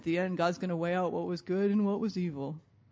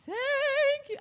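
much higher and louder, bursting; overjoyed thanks; 7 dB louder than usual. Retold or imitated. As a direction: cry out in joy. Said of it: "He goes".

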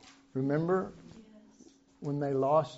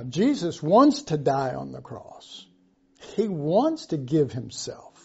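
ordinary talk

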